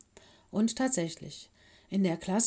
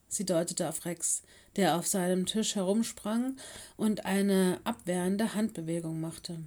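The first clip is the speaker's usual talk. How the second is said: plain reading voice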